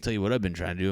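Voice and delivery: normal talking voice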